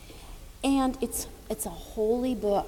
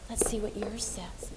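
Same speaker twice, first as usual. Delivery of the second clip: whispering; 7 dB quieter than usual. Real, not acted. Leaning in to child.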